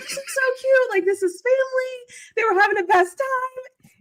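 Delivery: high, slow gushing; high, drawn-out delight. Retold or imitated. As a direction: coo over the family photos. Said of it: "I'm like".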